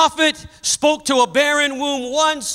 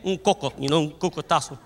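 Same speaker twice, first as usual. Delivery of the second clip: Spanish aside; 7 semitones lower, 6 dB quieter, faster than usual. Acted. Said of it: "If you're Latin, you understand".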